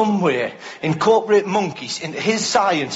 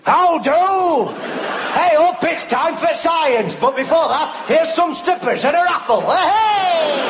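Yorkshire Hawking; 9 semitones higher, slower than usual. Pretend. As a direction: bellow it like a northern club host. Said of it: "if Hawkins had a Yorkshire voice".

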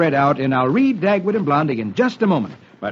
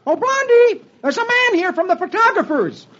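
very high-pitched husband's holler; high, yelping call; shouting; 13 semitones higher than usual. Acted. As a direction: call through the house to your wife. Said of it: "He shouts".

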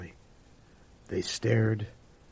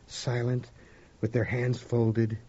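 ordinary delivery

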